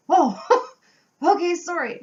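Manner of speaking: high, loud, laughing sorry; laughing apology. Real, not acted. Apologizing to the caller for her guess, laughing high and loud. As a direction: laugh through an embarrassed apology.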